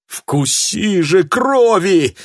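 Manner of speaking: high, loud, slow pleading. A narrator's reading. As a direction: beg it, drawn out. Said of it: "взмолился Харвуд".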